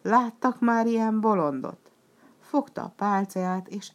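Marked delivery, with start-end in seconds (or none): 0.0-1.9 loud, high jeering question; mocking jeer. Arlecchino jeering at Piero before the audience. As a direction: play it up to the crowd, scornful.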